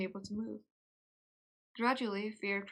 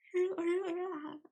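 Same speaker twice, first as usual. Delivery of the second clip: high laugh; 9 semitones higher than usual. Real, not acted. Laughs at own yelling.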